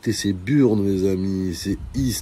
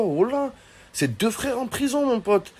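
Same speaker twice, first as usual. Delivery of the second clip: much higher, startled shout of disbelief; shocked wesh; 14 semitones higher than usual. Pretend. Hearing her brothers are in prison, shocked yelp.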